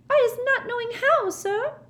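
very high, squeaky frightened elf voice; a terrified little elf gasps out her denial; gasping house-elf. A narrator's reading. Frightened house-elf to Mister Diggory, high squeaky gasp. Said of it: "Winky gasped".

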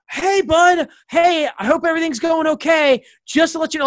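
a hint of a chipper father on the phone; cheerful dad voice. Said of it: "and he's like".

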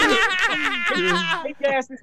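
words pushed out through loud laughter; laughing. Real, not acted. Guest laughing as he starts telling the host the story. Laughing while retelling the fight.

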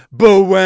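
short, loud imitated horn right in the ear; mock horn blast. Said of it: "Another fucking horn".

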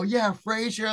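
high-pitched retold hype; all hyped up. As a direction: act pumped up along with a fan. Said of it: "got all hyped up".

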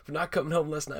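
high-pitched put-on nagging voice; scolding girlfriend. Acts out angry girlfriend scolding Porky.

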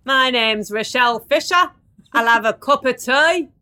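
a hammed-up go at a northern accent, Birmingham creeping in; mock Birmingham accent. Host faking the guest's accent, mock Birmingham accent. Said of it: "went into a bit of a Birmingham accent".

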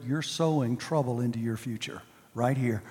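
normal talking voice

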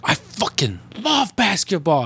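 high, slow mock bellow; ranting fan. Fan declaring his love to a stranger, hammed-up fervor. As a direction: drag it out like an overexcited fan.

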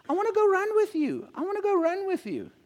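put-on high, small child's voice begging; little boy's eager plea. Son begging his dad to go running, high childlike voice. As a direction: squeak it out like an excited little boy.

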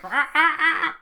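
very high, loud monster screech. Retold or imitated. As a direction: screech like a winged beast. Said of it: "lets out this sick screech".

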